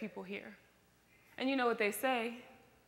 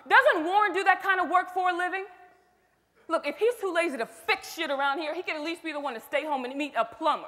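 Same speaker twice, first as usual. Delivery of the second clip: louder and higher, furious at the husband; angry outburst; 5 semitones higher, 6 dB louder than usual. Acted. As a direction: let the anger spill out at him.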